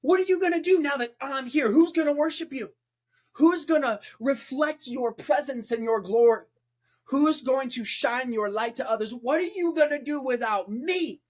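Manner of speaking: high mocking fallen-angel voice, defiant; taunting Lucifer. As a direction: goad God like a spiteful fallen angel. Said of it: "He's like".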